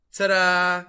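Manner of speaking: triumphant fanfare shout; loud ta-da. Acted. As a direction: announce yourself like a showman. Cheese showing off its title.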